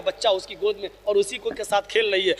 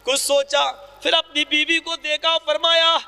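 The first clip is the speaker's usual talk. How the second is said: raised, fervent sermon voice driving the story; impassioned preaching; 7 semitones higher than usual. Acted. Preacher to his brothers, retelling the caliph's stand, fervent. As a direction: preach it with rising urgency.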